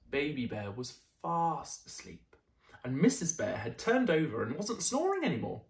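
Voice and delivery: ordinary delivery, nothing marked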